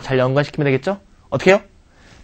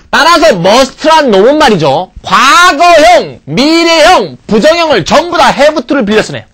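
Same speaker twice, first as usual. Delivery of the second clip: emphatic listing; 10 semitones higher, 9 dB louder, slower than usual. Real, not acted. Teacher emphasizes must borrows forms.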